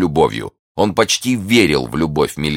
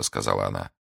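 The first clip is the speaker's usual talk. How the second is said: plain reading voice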